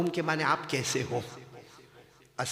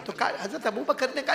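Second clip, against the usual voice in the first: ordinary talk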